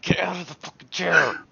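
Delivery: loud, fed-up shout at a friend; loud fed-up snap; exasperated yell. Acted. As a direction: yell it across the room in frustration. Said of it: "I'm busy staring at Yari like".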